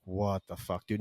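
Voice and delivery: low, soft aside to himself; inner disbelief. Retold or imitated. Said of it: "in my head, I was just like".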